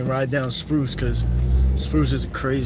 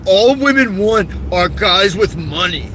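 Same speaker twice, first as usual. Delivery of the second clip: slow, higher, louder mock bro voice; mocking alpha male; 3 semitones higher, 4 dB louder, slower than usual. Vlogger mocking TikTok alpha males, slow exaggerated voice. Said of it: "They be on there".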